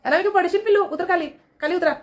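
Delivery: high-pitched coaxing call; fond warning call. Real, not acted. Owner tells climbing pet to get down, coaxing. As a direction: coax the little one down in a high voice.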